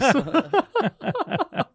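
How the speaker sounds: high-pitched laughing fit; laughter. Real, not acted. Host cracking up at the Air Isis pun, high laughter.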